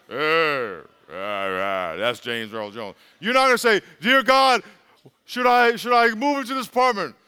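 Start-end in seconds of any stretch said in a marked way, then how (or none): 0.1-2.9 very low James Earl Jones rumble; deep booming voice. Retold or imitated. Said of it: "That's the voice". 4.0-7.2 loud mock prayer.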